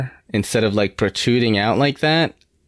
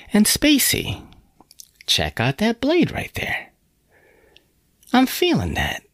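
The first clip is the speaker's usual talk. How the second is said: hyped high voice; 13 semitones higher than usual. Real, not acted. Knife reviewer hyping a blade.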